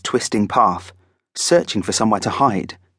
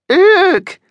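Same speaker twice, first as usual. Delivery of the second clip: high, loud strangled shriek; words cut off by a frightened squeal; little shriek; 8 dB louder than usual. A narrator's reading. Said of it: "died in his throat with a little shriek".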